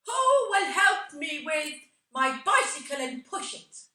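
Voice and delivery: loud laughing call-out to the audience; shouted call. Retold or imitated. Irish actress calling out to the audience, loud laughing shout. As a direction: belt it out to the crowd with a laugh. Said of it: "she shouted out".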